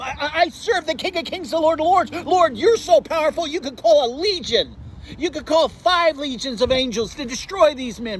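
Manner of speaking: high, impassioned preacher's voice voicing the prophets; preacher crying out the prophets' prayers; fervent preaching. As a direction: climb to a pleading pulpit cry. Preaching builds to full force.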